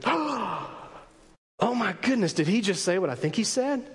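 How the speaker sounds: acted scandalized disbelief; mock-appalled questioning; shocked, upset. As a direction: play a shocked onlooker after a gasp. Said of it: "They are upset at what he says".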